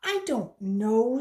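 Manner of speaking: loud, slow distressed answer; dragged out, near tears; upset girl. A narrator's reading. Girl under questioning.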